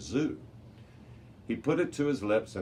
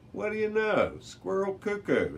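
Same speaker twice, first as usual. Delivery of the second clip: surprised; 13 semitones higher, 3 dB louder than usual. Acted. Javier surprised by the carving.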